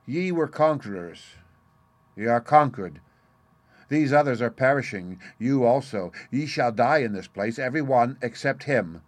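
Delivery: loud, gloating pronouncement; malicious satisfaction. A narrator's reading. Disguised Merlin gloats over his doomed captors, with malicious satisfaction. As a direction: relish the doom you are pronouncing. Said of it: "with an accent of malicious satisfaction".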